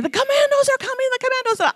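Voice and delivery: high, panicked cry of alarm; retold panicked scream. Missionaries on the radio screaming in panic. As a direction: cry out that the commandos are coming. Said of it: "they were screaming".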